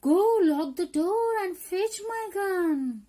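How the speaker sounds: loud, high, drawn-out command; urgent order. Acted. Poem character ordering the child to fetch the gun, urgent order. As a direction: call out each word slowly and high. Acting the poem's alarmed order.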